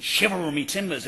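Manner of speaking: a light West Country pirate growl; a hint of a West Country pirate. Lecturer mocks the Disney pirate cliché, mild West Country accent. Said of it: "you should shout in a West Country accent".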